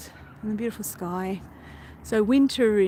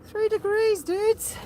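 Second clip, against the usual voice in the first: much higher voice, incredulous at the cold; high, squeaky amazement; high-pitched exclaiming; 13 semitones higher, slower than usual. Real, not acted. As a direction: squeal it in disbelief.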